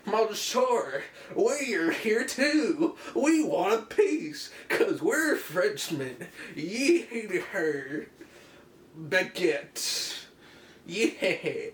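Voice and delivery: high, drawn-out, hammed-up French accent; bad French accent. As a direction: do a loud, slow caricature Frenchman accent. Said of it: "how to do a French accent".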